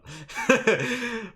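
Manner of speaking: high, loud chuckle; self-amused high giggle; laugh. Real, not acted. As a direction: laugh at your own silly line. Laughs at own silly line.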